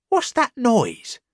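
high, slow, curious question; a cart driver wondering aloud; puzzled driver. Acted. Driver in the pub asking about the noise, high and puzzled. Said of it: "asked the driver".

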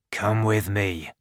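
low, firm police command; stern order. A narrator's reading. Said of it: "he ordered".

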